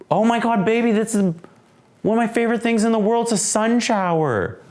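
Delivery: high, thrilled and joyful; excited delight. Real, not acted. Seeing a sun shower out the window, thrilled and high. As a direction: burst out with joy at the sight.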